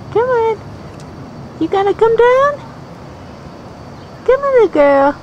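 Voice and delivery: high pleading coax. Real, not acted. Owner coaxing puppy down the step.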